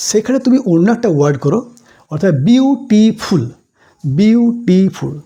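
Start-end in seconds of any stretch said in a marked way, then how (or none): 2.5-3.4 "beautiful" slow, in three distinct beats; syllable demonstration. Shown as a demonstration. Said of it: "জিভের গতি তিনবার বদলালো". 4.0-5.1 "beautiful" very slow, each syllable held; syllable demonstration. Shown as a demonstration. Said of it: "জিভের গতি তিনবার বদলালো".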